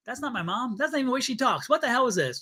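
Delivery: high, hushed mock disbelief; bewildered protest. Acted bewildered reply to Endora.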